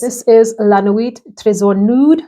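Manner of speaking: playful fake French accent; mock French accent. Perfume reviewer putting on a French accent for viewers. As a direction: sound all French, hammed-up French accent. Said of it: "I said that like I was trying to sound all French".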